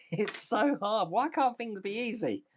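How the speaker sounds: high-pitched, exasperated whine with a laugh behind it; mock whining. Real, not acted. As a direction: moan playfully about how hard it is. Mock complaint about a fiddly build.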